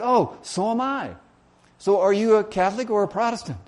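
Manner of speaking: high, eager acted delight; keen, lifted-pitch interest; pleasantly surprised. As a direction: play up finding common ground. Joke character delighted at shared faith.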